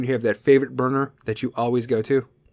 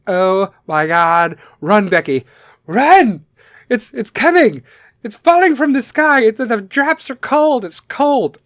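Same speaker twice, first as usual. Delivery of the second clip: high, loud mock panic screaming; panicked screaming; 7 semitones higher, 6 dB louder, slower than usual. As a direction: scream like the rain will kill you. Mocking panicked parkgoers fleeing rain.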